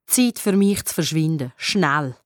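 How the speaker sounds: usual speaking voice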